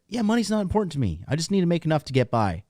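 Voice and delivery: high, fast, breezy mock voice of a naive guy; mock carefree guy. Ranting vlogger mocks a carefree guy, in a high, breezy voice. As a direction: toss it off like a guy who doesn't care about money. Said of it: "you're just like".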